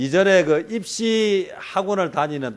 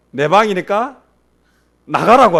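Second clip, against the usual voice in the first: bossy order; 4 semitones higher, 6 dB louder than usual. Retold or imitated. Daughter ordering dad out.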